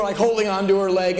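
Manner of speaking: ordinary delivery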